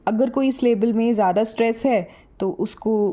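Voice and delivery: usual speaking voice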